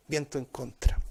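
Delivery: ordinary talk, nothing marked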